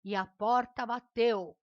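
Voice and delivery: loud, slow dramatic narration; story narration demo. Shown as a demonstration. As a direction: build up loudly to a sound effect. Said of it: "Como assim faz sons?".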